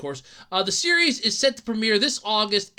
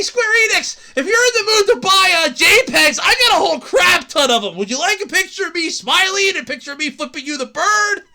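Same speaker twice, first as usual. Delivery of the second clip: high, loud hustler pitch; mock sales pitch; 7 semitones higher, 5 dB louder than usual. Streamer mockingly selling pictures to Square Enix, loud hustler pitch. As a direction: hawk it like a huckster.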